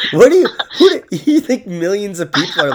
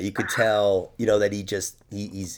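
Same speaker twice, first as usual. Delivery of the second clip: ordinary talk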